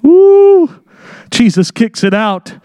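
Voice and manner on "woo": high loud preacher woo; loud excited woo; excited whoop. Real, not acted. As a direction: let out a joyful whoop.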